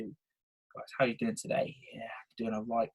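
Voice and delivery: soft, trailing, near-whispered greeting; too relaxed. Shown as a demonstration. Coach showing bad body language on a call, too relaxed. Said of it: "this guy's a bit too relaxed".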